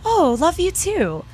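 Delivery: lightly voiced sheepish guy, high and slow. Retelling a guy's reply at the festival, mock sheepish. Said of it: "he went".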